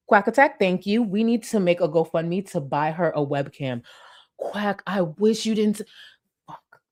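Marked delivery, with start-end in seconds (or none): none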